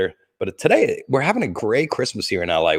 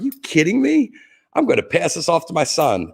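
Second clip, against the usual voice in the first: pitched-up mock disbelief; incredulous retort; 8 semitones higher than usual. To his family who say sell the buckle, mock-outraged refusal. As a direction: scoff as if they asked the impossible. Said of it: "I'm like".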